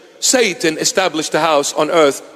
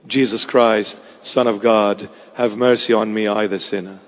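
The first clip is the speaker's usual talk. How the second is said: prayer; 8 semitones lower than usual. Shown as a demonstration. Preacher repeats the bead prayer to the congregation, low humble plea. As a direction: murmur it as a humble plea, fading at the end. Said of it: "This is the prayer of the beads".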